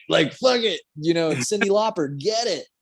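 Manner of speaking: telling himself to let loose, playful high cheer; mock triumphant cheer. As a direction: cheer yourself on carelessly. Retold triumphant self-talk.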